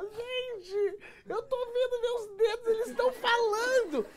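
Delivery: very high wailing squeal; hammed-up tearful freak-out, high-pitched; mock crying. Stoned wife seeing her fingers talk, high mock crying panic. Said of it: "ela começou a chorar".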